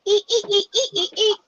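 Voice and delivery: high squeaky ee ee ee with a laugh; squeaking like a mouse. Retold or imitated. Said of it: "I'm sitting here going".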